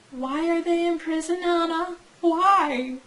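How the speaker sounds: high, sustained mock lament; mock wailing. Reader pleading with author Anna, high mock wail. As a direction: plead theatrically, pitched well up.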